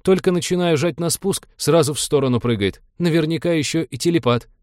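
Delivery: high-pitched aggrieved excuse; offended navigator, complaining high; hurt whine. A narrator's reading. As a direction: plead your case in a wounded high voice. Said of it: "обиженно всхлипнул навигатор".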